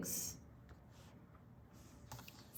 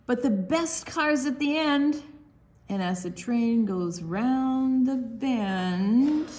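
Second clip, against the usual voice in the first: higher and louder, building to the end; excited storybook reading; 7 semitones higher, 4 dB louder, slower than usual. Acted. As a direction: carry the kids to the happy finish. Reads book ending aloud.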